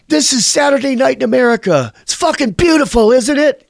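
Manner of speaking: high-pitched mock scream at the sky; retold sarcastic scream. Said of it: "I ended up screaming".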